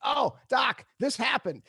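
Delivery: high, loud, bursting with news; excited to therapist. Retold or imitated. A patient bursting to tell his therapist big news, loud and excited. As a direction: blurt it out loud to the doc. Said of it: "sat down on the couch and said".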